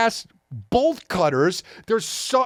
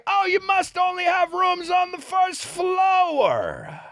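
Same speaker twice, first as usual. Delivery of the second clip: high, slow mock-innocent sneer; sarcastic taunt; 13 semitones higher, slower than usual. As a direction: play dumb sarcastically, voice way up. Sarcastic reply to the bellhop.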